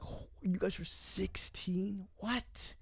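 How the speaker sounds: hushed, high-pitched disbelief; stunned, quiet squeak of disbelief; incredulous. Retold or imitated. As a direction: retell your shock, slow and soft. Retelling his disbelief at their age.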